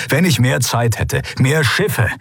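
worked-up, emphatic reproach; frustrated outburst. A narrator's reading. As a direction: let the frustration climb as you argue. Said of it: "beschämt von seinem Ausbruch".

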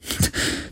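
a quiet, voiceless chuckle; soft breathy laugh. Acted. Girai laughs softly at her pirate joke, voicelessly. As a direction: let out a hushed little laugh.

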